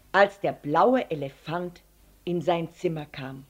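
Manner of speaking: plain reading voice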